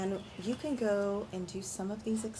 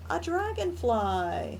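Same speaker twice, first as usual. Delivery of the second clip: excited reveal; 8 semitones higher, 5 dB louder, slower than usual. Real, not acted.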